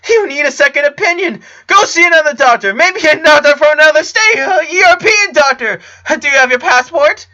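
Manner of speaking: very high, loud, breaking panic; frantic shouting. A narrator's reading. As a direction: shout wild ideas, near tears. Clyde panicking, pacing.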